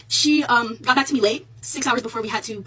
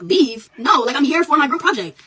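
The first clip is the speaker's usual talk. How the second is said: indignant, louder retort; raised voice; 4 semitones higher, 7 dB louder, slower than usual. Retold or imitated. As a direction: push back hard, voice up. Refusing the professor, getting loud.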